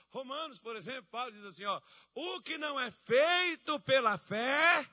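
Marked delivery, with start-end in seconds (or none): 2.1-4.9 high, loud, slow verse proclamation; preaching. Acted. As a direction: ring out the verse and leave the ending for the church. Preaching at full force.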